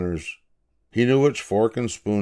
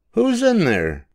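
put-on high, louder boy's call into the bushes; 13 semitones higher, 4 dB louder than usual. A narrator's reading. Davy calls into the bushes to an unseen kicker, high and loud. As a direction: call out like a curious boy.

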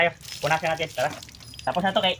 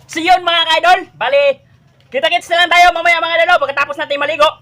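higher, louder upbeat sign-off to fans; vlogger sign-off; 8 semitones higher, 6 dB louder than usual. Acted. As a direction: cheerfully wrap up for the viewers. Vlog sign-off to viewers. (masiglang pamamaalam sa mga manonood)

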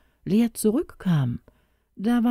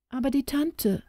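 startled cry; 9 semitones higher, 4 dB quieter than usual. Acted. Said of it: "rief sie plötzlich erschrocken".

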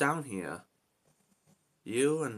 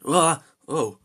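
sudden loud cry; startled yelp; 5 semitones higher, 13 dB louder than usual. Real, not acted. Gamer falling into quicksand, startled cry. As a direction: jolt out a shocked yelp.

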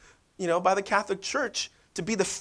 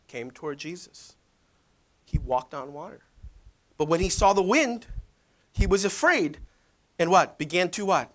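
normal talking voice

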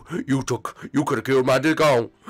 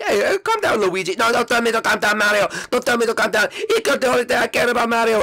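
loud and much higher, fast near the end; furious shouting; 11 semitones higher, 4 dB louder, faster than usual. Pretend. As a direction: shout him down, grieving and outraged. Luigi furious at the meeting.

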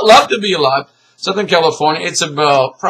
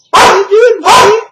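much higher, loud sing-song pet voice; 6 dB louder than usual. Real, not acted. Talking to pet cat.

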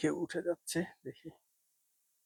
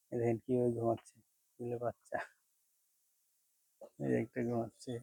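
hushed; 7 dB quieter than usual. Real, not acted. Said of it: "আমি আস্তে আস্তে কথা বলতেছি".

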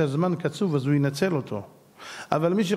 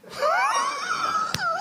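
high laughing cry. Real, not acted. Laughing hard at own story.

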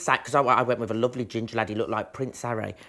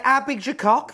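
loud cheeky question; 11 semitones higher, 6 dB louder than usual. Pretend. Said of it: "I'd go up to them and say".

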